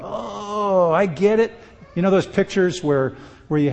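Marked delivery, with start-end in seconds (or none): 0.0-1.5 mock enlightened convert. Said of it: "People are gonna go".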